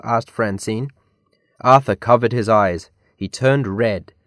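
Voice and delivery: ordinary delivery, nothing marked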